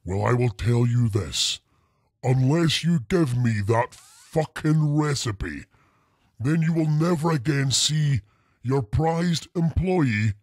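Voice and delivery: ordinary talk, nothing marked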